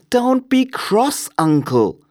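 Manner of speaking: high-pitched, slow coaxing; drawn-out, high gentle plea; placating nephew. A narrator's reading. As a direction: coax a cross uncle in a high voice. Nephew placates cross Scrooge.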